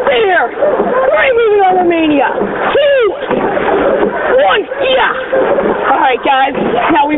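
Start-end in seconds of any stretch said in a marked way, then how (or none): 0.1-5.1 sharp counted stroke calls, higher; rowing coxswain calls. Acted. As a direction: drive the crew stroke by stroke.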